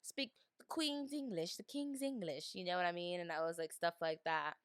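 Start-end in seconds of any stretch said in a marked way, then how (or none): 0.0-2.5 put-on higher-pitched playful British accent. American playing at the Queen's English, mock British accent. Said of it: "I have a little British accent".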